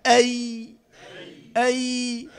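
isolated, drawn-out "أي" as a letter demo; ya articulation. Shown as a demonstration. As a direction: sustain the y of "أي" from mid-tongue. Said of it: "طيب، الياء".

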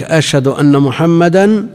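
أن said as أنّا with a doubled nun; showing the doubled nun. Shown as a demonstration. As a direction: hold the doubled nun of أن before محمدا. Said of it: "مشددة".

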